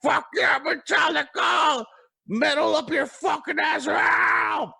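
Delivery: high, drawn-out mock scream; hammed-up concert yell, high and slow; imitated drunken fan scream. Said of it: "he kept screaming".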